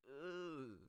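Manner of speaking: high, drawn-out ugh; disgusted ugh. Real, not acted. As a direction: recoil with a high-pitched groan. Disgusted by the word willy.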